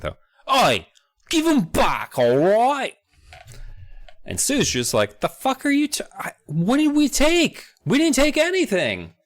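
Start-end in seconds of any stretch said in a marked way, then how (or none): 0.5-2.9 loud, high, mock British yob; British accent. Tower friend marching up to demand it back, in a hammed-up British accent. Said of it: "he says it in a British accent". 5.2-9.1 very high, squeaky mock indignation; indignant protest. Sue denying any theft, acted as high-pitched indignation.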